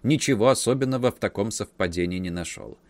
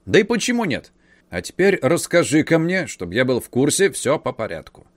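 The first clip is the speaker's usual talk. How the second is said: eager uncle; 6 semitones higher, 5 dB louder than usual. A narrator's reading. Uncle eager, pressing the nephew.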